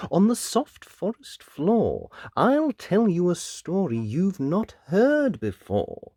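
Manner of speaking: lightly voiced wolf with drawn-out phrases; assured storyteller wolf. Wolf telling the giant a new story, assured.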